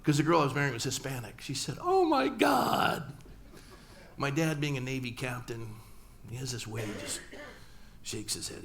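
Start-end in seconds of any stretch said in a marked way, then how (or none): 1.8-3.1 high, slow, stretched-out shriek of horror; mock scream. Mother shrieking at son's news, high and drawn out. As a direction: wail it like a shocked mother. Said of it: "she screamed".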